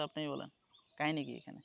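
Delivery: ordinary talk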